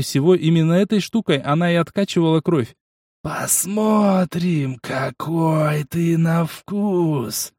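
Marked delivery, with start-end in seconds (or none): 3.2-7.5 slow, inhuman monster voice; eldritch horror. A narrator's reading. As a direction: savour each word like a beast. Monster threatens the hero.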